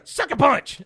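shouted, high and loud announcement of the move; mock yell of the move name; announced sucker punch. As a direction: call out the punch like a battle cry. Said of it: "I love how he announces sucker punch".

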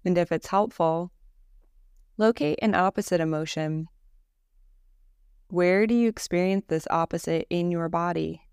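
calm, unhurried Yoga Nidra guide; guided meditation. Acted. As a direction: guide them gently into rest, with long pauses between phrases.

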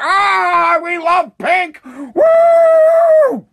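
high, loud screamed adoration ending in a whoop; mock ecstatic cheering. Mimicking Biden fans adoring pink, ecstatic cheering. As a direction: squeal it like a delirious fan.

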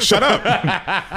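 laughing through gritted teeth; through clenched teeth. Real, not acted. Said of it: "Through clenched teeth".

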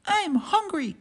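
high pleading squirrel; whiny squirrel cry. Acted. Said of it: "cried Cyril".

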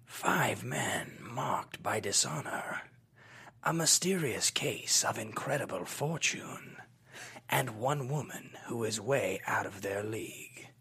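slow, whispered movie trailer narration; nineties trailer voice. Acted. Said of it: "my mid nineties trailer voice".

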